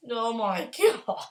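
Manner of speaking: normal talking voice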